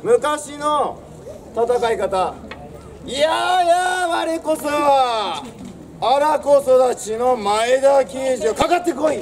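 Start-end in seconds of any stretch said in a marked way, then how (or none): 3.1-9.2 slow, high, drawn-out war cry; samurai battle-cry challenge. Retold or imitated. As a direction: call out your name to the enemy. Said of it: "っていうような感じで名乗りを上げる". (ゆっくり高く名乗りを上げる武者)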